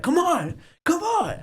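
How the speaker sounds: high-pitched imitation of a fired-up boxer; goading fighter. Imitates Rocky egging on opponent.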